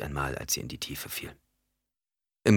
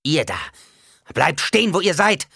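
loud, high shouted order; desperate caricatured command; 11 semitones higher, 8 dB louder than usual. A narrator's reading. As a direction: shout to force them under your control. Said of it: "eine Karikatur ihrer Stimme". (verzweifelt befehlendes Schreien)

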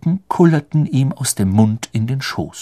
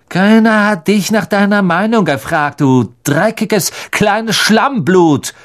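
higher, spat-out slur slowing at the end; snapping insult; 9 semitones higher, 3 dB louder than usual. A narrator's reading. Malfoy snapping an insult at Hermine, slowing on the slur. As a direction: bark it at her with contempt. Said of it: "blaffte er sie an".

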